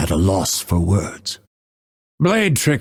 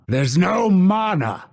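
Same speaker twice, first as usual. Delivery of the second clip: raised pitch, contained frustration; mild frustration; 7 semitones higher than usual. Acted. Fighter out of mana, mildly frustrated. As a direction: complain without yelling. Milder of escalating takes.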